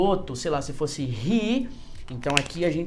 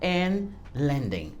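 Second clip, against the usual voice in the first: slow model sentence; slower than usual. Shown as a demonstration. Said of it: "usamos até um vocabulário aqui mais de aviação".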